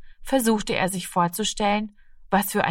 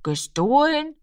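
higher, puzzled echo of an accusation; questioning the word; 8 semitones higher, slower than usual. A narrator's reading. Rami echoes Frau Lenzen's accusation, puzzled and questioning. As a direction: weigh the word as a doubtful question.

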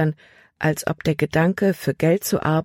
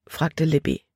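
plain reading voice

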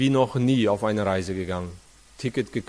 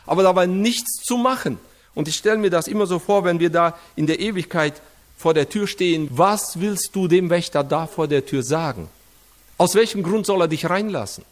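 raised, fast preacher's appeal to the congregation; preaching; 5 semitones higher than usual. Acted. Preacher to the congregation, drawing the closed-door lesson, rising sermon voice. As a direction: drive the moral home from the pulpit.

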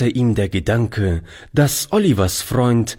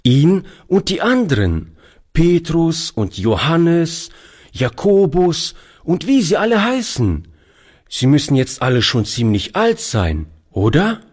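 put-on curious little boy; 9 semitones higher than usual. A narrator's reading. Boy Toni asking old Oliver about the apostles, high and curious. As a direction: ask it like a wide-eyed boy.

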